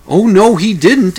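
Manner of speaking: high, loud "oh no he didn't"; outraged gasp of disbelief at the lawn; shocked dismay. Retold or imitated. Homeowner sees rival team message.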